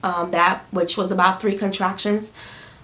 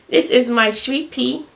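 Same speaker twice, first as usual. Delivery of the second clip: higher, slow cooing; 4 semitones higher, 4 dB louder, slower than usual. Real, not acted. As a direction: mom soothing her newborn. Soothing her baby.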